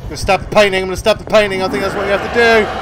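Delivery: loud, high frantic rush. Real, not acted. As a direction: shout it in a frantic hurry. Rushing to stab the painting.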